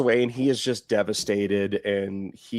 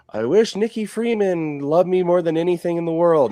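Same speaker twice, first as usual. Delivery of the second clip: imitated devastated wish; 6 semitones higher than usual. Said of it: "so he is just, like, devastated".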